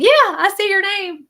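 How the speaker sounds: high, loud, cheery re-enactment; retold eager reply. Host re-enacting her answer to Brenda, eager and loud. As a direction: call it out like an enthusiastic yes.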